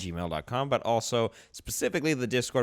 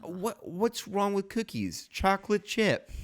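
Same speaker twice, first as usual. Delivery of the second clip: indignant disbelief; 10 semitones higher, slower than usual. Real, not acted. Indignant about cookies.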